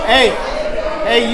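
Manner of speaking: ordinary delivery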